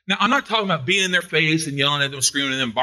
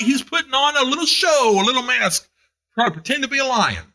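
worked up; 5 semitones higher than usual. Real, not acted. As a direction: let the excitement burst out. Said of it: "I know I'm getting worked up".

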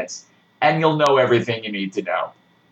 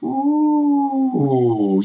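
mock ooh; 12 semitones higher than usual. Mock gasp at 3D news.

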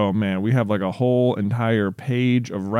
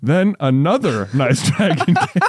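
higher, breaking into laughter; story reading overtaken by laughter; laughing while reading; 4 semitones higher, 4 dB louder, slower than usual. Real, not acted. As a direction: try to read on while cracking up.

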